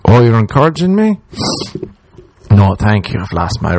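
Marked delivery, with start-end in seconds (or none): 0.1-1.2 mock surprise. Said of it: "mocking me during sex".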